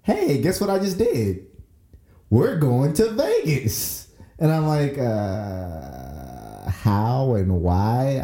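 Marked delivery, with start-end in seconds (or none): 0.0-4.1 high-pitched mock-excited wife. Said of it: "she wakes me up and she's like". 5.0-8.2 slow, drawn-out mock-groggy reply; groggy just-woken husband. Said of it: "literally deep in a dream".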